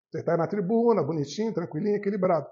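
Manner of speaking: normal talking voice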